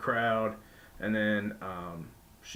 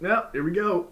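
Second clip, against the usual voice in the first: higher and louder, mock gung-ho; eager go-for-it; 8 semitones higher, 5 dB louder than usual. James Storm deciding to dive, a mild gung-ho burst. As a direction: throw yourself into it like a dare. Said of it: "even James was like".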